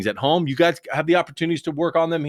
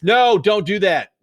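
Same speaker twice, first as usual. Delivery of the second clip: loud, slow, emphatic no; a teacher's firm warning, slow and loud; 7 semitones higher, 7 dB louder, slower than usual. Real, not acted. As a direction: stop them firmly.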